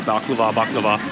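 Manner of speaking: ordinary talk, nothing marked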